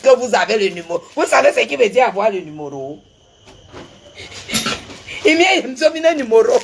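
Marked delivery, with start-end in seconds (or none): 5.2-6.6 slow words dissolving into a high, shrieking laugh; real laughter rising to a high shriek. Real, not acted. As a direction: burst out laughing at your own joke. Laughs at her own joke.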